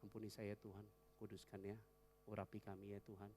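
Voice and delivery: soft, low coaxing plea; childlike quiet prayer. Shown as a demonstration. Believer asking God like a child asks a parent, soft. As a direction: ask God softly like a child. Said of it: "Sekarang kita sama Tuhan".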